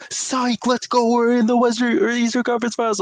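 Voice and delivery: high-pitched hyped-up fan imitation; mock gloating fan. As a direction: switch suddenly into a high, put-on celebrating voice.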